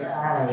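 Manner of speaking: mock primal grunt of anger; caveman growl. Comic getting upset for girlfriend, caveman growl. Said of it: "kind of that caveman".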